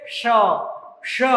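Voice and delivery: श sounded alone as a retroflex sh; retroflex sh demo. Shown as a demonstration. Teacher showing pupils how to pronounce it, retroflex श. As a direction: say श from the roof of the mouth, twice with a pause. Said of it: "इसका उच्चारण कैसे करेंगे?".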